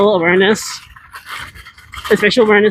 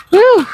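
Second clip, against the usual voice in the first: high loud whoop; excited whoop; 11 semitones higher, 5 dB louder than usual. Real, not acted. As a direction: let out a cheer. Cheering on the ride.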